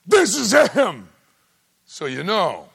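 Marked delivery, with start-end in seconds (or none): 0.1-1.1 high, loud shout from the sky; God calling out for all to hear; shouted declaration; booming announcement. Retold or imitated. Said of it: "tears the sky open and goes".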